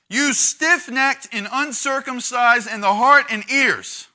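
slow, high, loud accusing cry; preaching rebuke. Acted. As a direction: thunder it at them slowly. Preacher voicing Stephen's rebuke.

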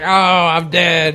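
slow, high hammed-up dying line; mock death cry. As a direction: play a comically bad death. Acts out stabbed character dying.

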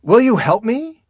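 high, loud cry for help; shouted, pleading question; desperate plea. A narrator's reading. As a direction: beg loudly, pitched right up. Hiker pleads with God for help.

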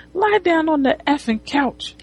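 high-pitched bossy order at normal volume; mock commanding. Woman ordering client onto the couch, mock commanding. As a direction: imitate a woman taking control. She takes control, orders him.

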